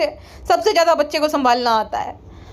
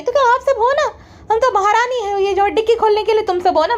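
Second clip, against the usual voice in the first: higher, haughty "queen" impression; mocking the vlogger who acts like a queen, pitched higher; 7 semitones higher than usual. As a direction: play a pampered queen ordering servants.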